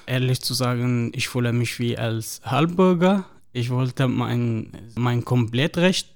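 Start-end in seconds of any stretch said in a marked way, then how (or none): none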